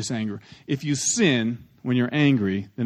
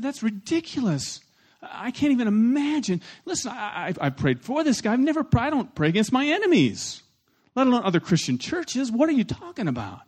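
higher, incredulous mock outrage; retold indignation; 9 semitones higher than usual. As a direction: replay the disbelief, pitched up high. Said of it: "I was angry".

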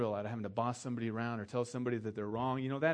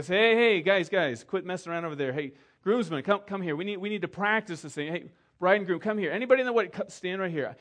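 loud, higher calls to round everyone up; calling out; 5 semitones higher, 7 dB louder than usual. Retold or imitated. Pastor calls the groomsmen and bride to gather, loud. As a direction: shout across the room to gather the wedding party.

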